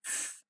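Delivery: short F, only air, no voice; unvoiced f sound. Shown as a demonstration. Teacher has students check their voice box, unvoiced F. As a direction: blow a soft voiceless F. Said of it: "The f sound is unvoiced".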